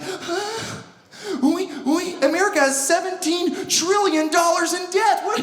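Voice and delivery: high, sputtering mock alarm; mock panic. Worrier fretting over national debt, hammed-up panic. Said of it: "somebody's like".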